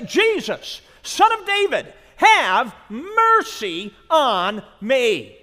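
loud, high desperate plea; crying out. Acted. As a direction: cry out to him from the roadside. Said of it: "he began to cry out and say".

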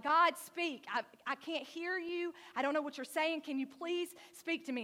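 a loud, high plea into the wilderness; pleading cry to God. Retold or imitated. Elijah cries out to God.

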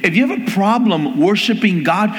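preaching at full force. Acted. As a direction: drive the point home to the congregation. Preaching build-up.

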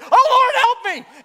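high, loud cry for help; panicked yell. Retold or imitated. Runner tumbling on the trail, panicked yell. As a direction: yell out in comic panic as if falling. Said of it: "I immediately said".

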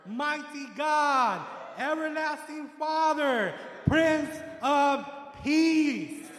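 slow, loud, drawn-out proclamation; proclaiming shout. Real, not acted. Preacher proclaims Jesus's titles to the church, slow loud shout.

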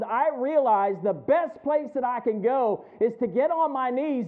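loud, high, impassioned preaching; full-force preaching. Acted. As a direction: build the congregation up with fire.

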